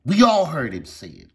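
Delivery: emphatic shout. Real, not acted. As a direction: stress WE ALL heard. Repeated, louder higher.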